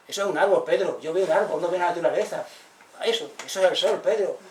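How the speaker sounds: exasperated retort. Retold or imitated. Felipe correcting Pedro, loud and exasperated. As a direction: set him straight, loudly and a bit fed up.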